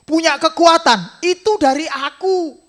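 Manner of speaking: high, loud voice of God reminding Peter; voicing God. Preacher voicing God reminding Peter, high and loud. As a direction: speak as the Lord, lifted and firm. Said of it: "Seolah-olah Tuhan ngomong gini".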